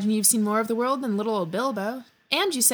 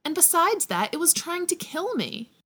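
indignant; 7 semitones higher than usual. Acted. Bilbo protesting to Hagrid.

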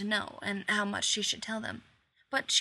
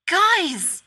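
ordinary delivery

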